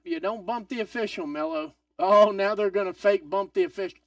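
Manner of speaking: usual speaking voice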